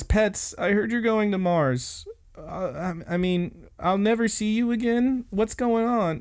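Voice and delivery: high-pitched, urgent mock plea; upset pleading. Retold confrontation between upset friends.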